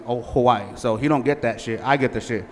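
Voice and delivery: ordinary talk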